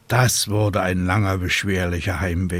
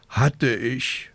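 sighing; 4 semitones higher than usual. A narrator's reading. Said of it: "seufzte die kleine Hexe".

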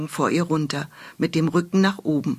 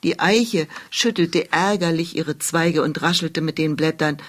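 usual speaking voice

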